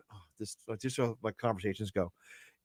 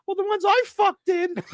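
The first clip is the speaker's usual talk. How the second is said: much higher cute dummy voice; squeaky puppet voice. Acted. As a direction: chirp it like a tiny cute puppet. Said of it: "He goes".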